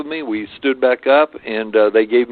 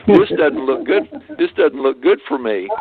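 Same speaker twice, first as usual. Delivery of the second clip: higher voice with a laugh in it; laughing, lightly acted alarm; amused mock dread; 7 semitones higher than usual. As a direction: chuckle through the retold worry. Laughing retelling of his own dread.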